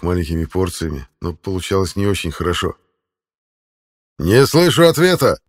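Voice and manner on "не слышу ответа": loud barked demand, high pitch. A narrator's reading. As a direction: bark it in his face. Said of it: "рявкнул Центурион".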